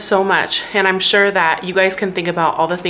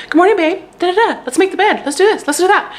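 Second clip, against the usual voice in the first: much higher, bouncy mock-cheerful husband voice; perky morning husband; 11 semitones higher, 3 dB louder, faster than usual. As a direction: imitate an overeager morning person, high and bright. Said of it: "he's like".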